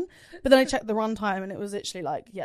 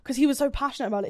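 usual speaking voice

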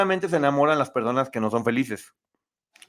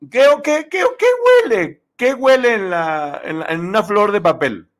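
incredulous shriek; 6 semitones higher, 6 dB louder than usual. Real, not acted. Mocking contestant sniffing paper flower.

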